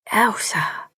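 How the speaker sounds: shocked whisper of her name; breathed. A narrator's reading. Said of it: "Rosie breathed".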